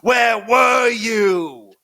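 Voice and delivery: drawn-out interrogation shout, high and loud; Sipowicz. Acted. Earl grills the baby for answers, mock interrogator shout. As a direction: roar each word slowly, hammed-up cop grilling. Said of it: "trying to go Sipowicz just on the baby".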